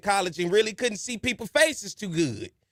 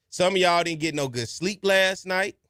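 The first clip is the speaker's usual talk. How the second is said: normal talking voice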